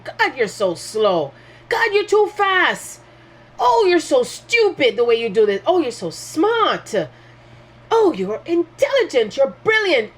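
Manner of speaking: sing-song mock voices, swinging high on the insults and praise; hammed-up taunting, half laughing at them; mock judging voices. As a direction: lightly voice petty critics flipping between praise and scorn. Mocking people's judgments of each other.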